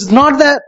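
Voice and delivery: that punched high and loud; emphatic denial. Real, not acted. Preacher dismissing money and talents, loud high denial. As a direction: slam the word that.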